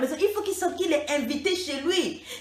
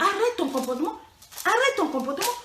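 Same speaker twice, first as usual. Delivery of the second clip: stern scolding; 5 semitones higher than usual. Acted. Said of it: "coach scolding, repeated".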